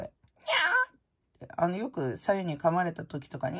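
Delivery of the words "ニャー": high, slow meow; a drawn-out "ニャー" like a cat. Retold or imitated. Imitating the hedgehog's cat-like cry, a high slow meow. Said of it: "ケンタは猫みたいな声".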